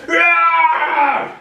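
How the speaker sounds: huge high roaring shout; Thor's roar. Acted. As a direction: roar like an angry thunder god. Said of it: "the gods are woken up by Thor going".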